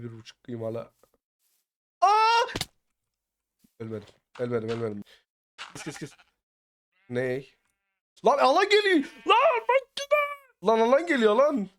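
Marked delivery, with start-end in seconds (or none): 2.0-2.5 high, loud "aa" shriek; sudden loud cry; startled scream. Real, not acted. Startled cry during game action. 8.3-11.7 very high, loud alarm yell; real high-pitched panic in mid-game; panicked shouting. Real, not acted. Shouts that the zone is closing.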